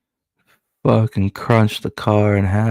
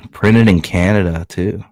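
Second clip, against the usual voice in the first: normal talking voice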